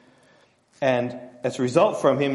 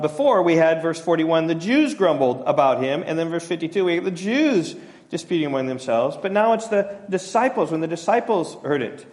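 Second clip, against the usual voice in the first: usual speaking voice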